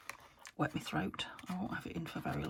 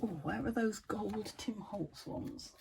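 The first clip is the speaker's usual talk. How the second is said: ordinary talk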